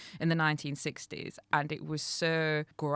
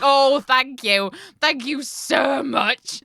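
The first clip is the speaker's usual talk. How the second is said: slow, loud, higher mock gratitude; sarcastic thanks; 8 semitones higher, 12 dB louder, much slower than usual. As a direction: drip the thank you with sarcasm. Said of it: "I was like".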